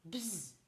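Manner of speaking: bourdonnement bzz à la place du mot; bruit bzz. Shown as a demonstration. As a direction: fais bzz comme une abeille. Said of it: "des fois on fera". (bruit bzz)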